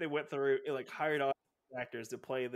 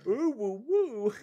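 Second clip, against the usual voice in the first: high rising hoots; excited hooting; 6 semitones higher, 4 dB louder, much slower than usual. Retold or imitated. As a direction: hoot like a thrilled fan. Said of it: "We'd be like".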